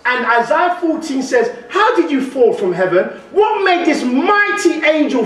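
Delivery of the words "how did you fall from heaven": normal talking voice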